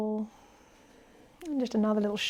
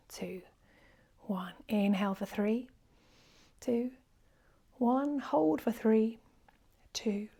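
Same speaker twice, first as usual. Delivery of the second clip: guided breath count; 4 dB quieter than usual. Acted. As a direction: hush the voice and count the breaths gently. Leads whispered breath counting.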